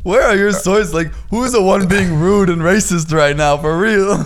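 high, loud, indignant demand; incredulous challenge. Retold or imitated. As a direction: throw the accusation back at him in retelling. Retold argument, repeats the challenge.

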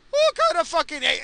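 outraged rant. Real, not acted. Ranting about the driver.

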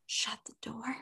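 hushed whisper; mock secret whisper. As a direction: whisper it urgently. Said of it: "He goes".

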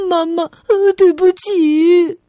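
loud, high tearful apology; acted sobbing, drawn-out; crying child. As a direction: wail sorry like a little girl. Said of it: "阿丽哭着说".

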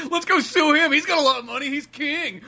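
hammed-up townsfolk scheming to sue the king; mocking voice. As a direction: taunt him like a gossiping crowd. Mocking people who blame Aquaman.

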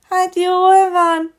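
high, slow whining reluctance; whiny fox girl. A narrator's reading. A pouting fox girl whines to the teacher, high and slow. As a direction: drag it out like a sulky little fox. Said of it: "mondta nyafogva Regina".

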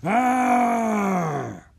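long loud monster roar; ogre roar. A narrator's reading. Ogre stomping at Drake, long loud roar. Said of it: "The ogre roared".

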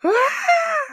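high-pitched imitation scream; reenacted scream. Daughter made to kill a chicken, mock screaming. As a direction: let out a thin, very high "ah". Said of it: "I was screaming".

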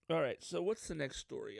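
usual speaking voice

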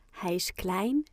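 ordinary delivery

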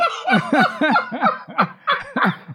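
high burst of real laughter; laughing. Real, not acted. Laughs at the trailer joke.

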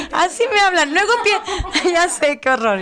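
laughing. Real, not acted. Laughing at herself after blurting out the angels' question. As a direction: talk through high embarrassed laughter.

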